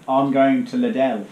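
Liddell said slowly, with an L on the end; slow Bristolian Liddell with an added L. Shown as a demonstration. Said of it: "put an L on everything".

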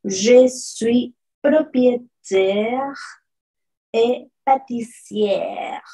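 je suis propriétaire et pâtissière spoken very slowly, syllable by syllable; slow French demo. Shown as a demonstration. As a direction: read the French sentence slowly for learners. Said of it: "Aquí tenemos".

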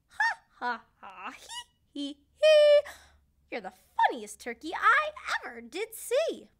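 high cackling hee hee, then loud teasing; mocking cat laugh. A narrator's reading. Cat mocking the green turkey, high and loud laughing. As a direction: laugh at him like a mean cat. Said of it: "a yellow cat say".

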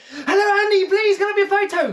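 very high, loud, eager squeal; breathless high-pitched fan plea; excited fan squeal. Retold or imitated. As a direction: squeal it like a starstruck kid. Retold ambushing a footballer.

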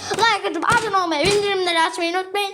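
bright channel outro; upbeat outro. Acted. Youtuber to viewers, upbeat outro.

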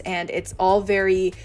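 normal talking voice

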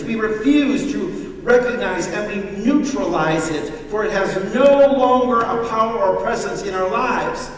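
loud, high pulpit preach; fervent sermon delivery; full-force preaching. Acted. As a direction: preach it out to the congregation.

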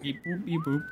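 beeping like a little droid; robot beeps. Pretend. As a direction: chirp it out like a robot. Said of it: "Did you hear all the beep boops?".

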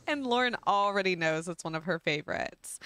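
normal talking voice

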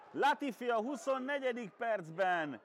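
high, keyed-up naming of the player; excited hungarian sports commentary. Acted. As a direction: still buzzing from the chance. Naming the shooter after the chance.

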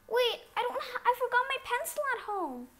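high, fast, loud gasp of panic; panicked student. Pretend. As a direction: blurt it out like a panicked kid. Student panics about pencil.

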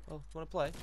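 normal talking voice

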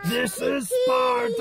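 high, slow Spartan war shout; barbarian. Pretend. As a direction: bellow it like a movie warrior. Spartan movie quote shouted in costume.

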